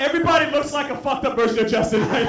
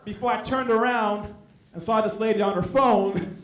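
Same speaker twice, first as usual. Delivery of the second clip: normal talking voice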